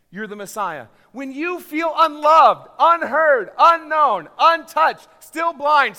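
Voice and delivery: loud, high, driving sermon delivery; impassioned preacher at full volume; full-force preaching. Acted. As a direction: hammer each phrase out to the congregation.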